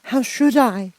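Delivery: put-on high, slow child's voice; a startled girl answering; bewildered little girl. A narrator's reading. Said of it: "answered Dorothy".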